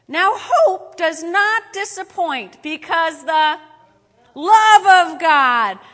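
preacher booming the verse; booming scripture reading. Acted. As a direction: read it out like thunder from the pulpit.

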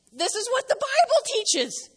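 ordinary delivery

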